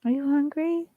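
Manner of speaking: high, sing-song pet voice. Real, not acted. To her dog Muffin, high cooing baby talk. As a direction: coo at the dog.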